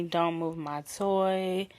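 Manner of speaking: low, slow commanding lover's voice; aggressive sexy voice. Retold or imitated. A dominant lover giving orders in bed, low and slow. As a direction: lay down the rules slowly, in a low voice. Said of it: "in a aggressive voice".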